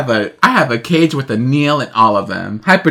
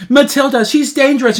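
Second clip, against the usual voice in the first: high imitating voice; 11 semitones higher than usual. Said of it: "she goes".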